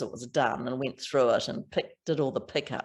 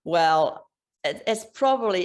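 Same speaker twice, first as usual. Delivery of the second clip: ordinary talk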